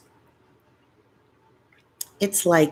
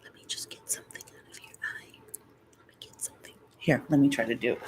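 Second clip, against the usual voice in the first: fast intimate ASMR whisper; 5 semitones lower, 9 dB quieter, much faster than usual. Shown as a demonstration. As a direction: whisper close like tending an eye. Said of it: "they say things like".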